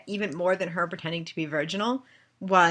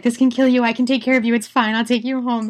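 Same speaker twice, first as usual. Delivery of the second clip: higher, louder fussing protector; mock protective knight, higher and louder; protective Mary; 5 semitones higher, 6 dB louder, faster than usual. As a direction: take charge of her lady. Voicing Mary protecting Lola.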